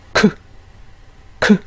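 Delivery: short isolated 크 with a strong puff of air; forcefully breathed k. Shown as a demonstration. Teacher modelling for students to try, strongly aspirated 크. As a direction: expel a hard h through the k of 크. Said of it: "흐를 강하게 내뱉으면".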